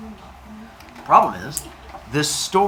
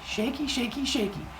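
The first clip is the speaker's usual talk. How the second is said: put-on lightly higher, softer babyish singsong; mock-baby shaky chant; cutesy kid; 10 semitones higher, 8 dB quieter than usual. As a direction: coo it like a toddler shaking hands. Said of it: "He's like".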